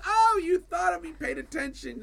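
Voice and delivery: high, slow, moved voice; a wife charmed by the gift; touched wife. Retold or imitated. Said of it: "She goes".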